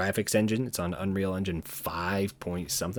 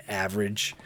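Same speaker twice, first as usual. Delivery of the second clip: ordinary talk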